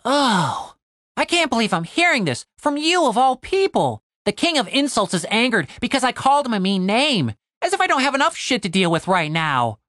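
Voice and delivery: high, exasperated complaint. A narrator's reading. Tim hears Cooper's grievance, extra exasperated. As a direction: start on a sighed oh, then gripe high. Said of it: "let out an extra exasperated sigh".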